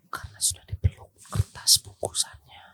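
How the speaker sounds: whispering. Real, not acted. Whispered riddle punchline.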